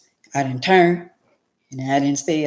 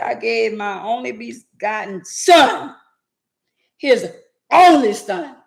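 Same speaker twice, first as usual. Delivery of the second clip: voicing God; 6 semitones higher, 6 dB louder, slower than usual. Voicing God's reply.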